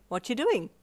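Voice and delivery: bright. A narrator's reading. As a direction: ask it cheerily. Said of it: "Ichiro said brightly".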